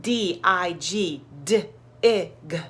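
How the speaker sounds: put-on careful slow spelling for a child; sounding out letters. Shown as a demonstration. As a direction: spell each letter slowly and clearly. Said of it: "sounded out words like".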